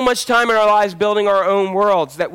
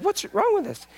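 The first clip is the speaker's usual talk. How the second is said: much higher, softer mock astonishment; astonished; 14 semitones higher, 6 dB quieter than usual. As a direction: squeak it out in disbelief. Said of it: "She's like".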